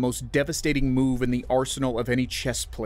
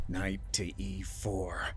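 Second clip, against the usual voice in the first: very soft, slow, tight call; tense voice; 4 semitones lower, 9 dB quieter, slower than usual. A narrator's reading. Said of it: "his voice tight with the tension".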